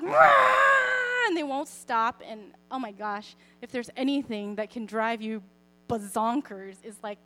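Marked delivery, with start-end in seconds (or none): 0.0-1.3 loud, high imitated screech; mock toddler scream. Mom imitating her screaming toddlers, high loud shriek. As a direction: let out a tantruming toddler's wail. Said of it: "toddlers screaming".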